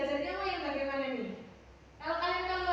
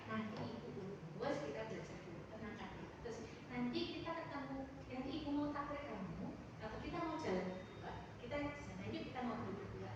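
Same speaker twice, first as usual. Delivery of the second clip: calm soothing; 3 semitones lower, 8 dB quieter than usual. Acted. Teacher calming an upset pupil.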